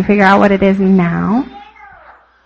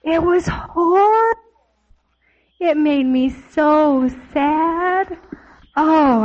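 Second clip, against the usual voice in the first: mock sad whine; 4 semitones higher, slower than usual. Acting out broken piano.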